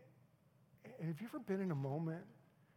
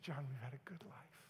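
soft breathy whisper; quoting a dying wife, whispered; dying wife's whisper; 8 dB quieter than usual. Retold or imitated. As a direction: whisper it like her last words. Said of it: "she said".

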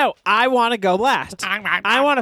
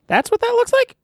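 high loud disbelief; incredulous; 4 dB louder than usual. Real, not acted. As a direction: squeak it out incredulous.

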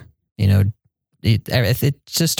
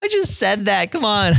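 much higher, whiny disbelief; exasperated protest; 11 semitones higher than usual. Retold or imitated. Missionary frustrated his words weren't understood, high exasperated whine. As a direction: complain that nobody heard you the first time. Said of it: "And I was like".

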